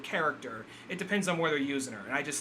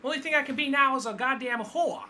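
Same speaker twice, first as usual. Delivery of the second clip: put-on much higher Brooklyn accent, full quote; hammed-up Brooklyn twang, pitched way up; 10 semitones higher than usual. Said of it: "I like the way she even said it in the Brooklyn accent".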